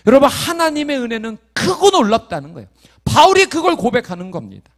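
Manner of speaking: high, loud fervent preaching; preaching at full force. Acted. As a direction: proclaim it, lifting the whole room. Preacher proclaiming grace.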